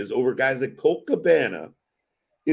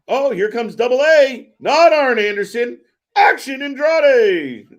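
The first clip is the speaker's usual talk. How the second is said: loud high play-by-play shout; excited call; 9 semitones higher, 8 dB louder than usual. Real, not acted. Host calling Andrade's run-in live, shouting with excitement. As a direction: call the entrance like ringside hype, building into a laughing shout.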